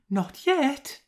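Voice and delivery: very high-pitched little reply; excited onlooker. A narrator's reading. Onlooker reporting on the chase, very high voice. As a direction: pipe it up high.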